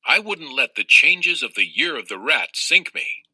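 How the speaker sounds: plain reading voice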